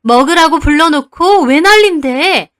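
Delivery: loud, high snapping retort; angry retort. Acted. Aunt stands up and snaps at mother, angry. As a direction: snap back in outrage. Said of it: "큰엄마도 일어나서 따지시더라고요".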